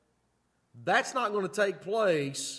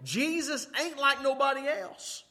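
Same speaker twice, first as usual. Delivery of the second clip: mock scream; 7 semitones higher than usual. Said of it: "these miracles are gonna scream to you".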